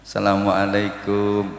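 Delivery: low, slow, level call at the door; visitor's greeting. Shown as a demonstration. Guest arriving at someone's house, polite and measured. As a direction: announce yourself politely, drawn out and low. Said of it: "Nanti kalau bertamu, beda lagi".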